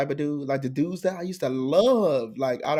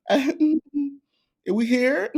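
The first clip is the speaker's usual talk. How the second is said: high slow mock question; 11 semitones higher, much slower than usual. Retelling nervous question laughing.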